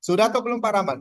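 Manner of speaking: loud, high call over choppy audio; speaking up over bad line. Real, not acted. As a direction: raise your voice so he hears. (berseru keras ke peserta yang suaranya putus-putus)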